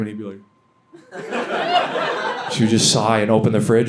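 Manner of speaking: usual speaking voice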